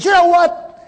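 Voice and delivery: frustrated outburst. Retold or imitated. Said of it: "he was so frustrated".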